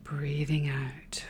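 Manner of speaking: slow, barely there whisper. Real, not acted. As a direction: let it fade to a whisper. Whispered breathing cue.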